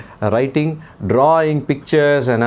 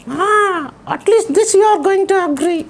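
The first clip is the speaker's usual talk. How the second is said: put-on puppet boy voice. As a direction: play the cheeky little boy puppet. Puppet child voice.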